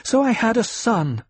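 high, loud flare of anger; sudden angry retort; flaring. Acted. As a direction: snap back, goaded into anger. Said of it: "Jessica flared".

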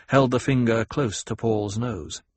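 ordinary delivery

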